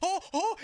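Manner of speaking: high, mock yelps of pain; mimicked pain yelps. Storyteller imitating a friend pulling a hamstring, mock pain yelps. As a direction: imitate someone yelping as a muscle pulls.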